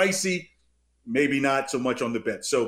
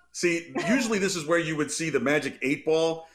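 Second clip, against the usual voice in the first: normal talking voice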